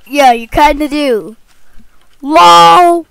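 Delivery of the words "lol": loud exclamation. Acted. Laughing exclamation.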